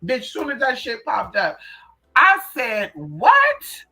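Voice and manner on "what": shocked what. Retold or imitated. Shocked on seeing search result.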